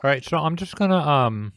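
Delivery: talking while chewing chocolate; mouth full. Real, not acted. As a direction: speak around a mouthful of chocolate. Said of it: "stop talking with my mouth full".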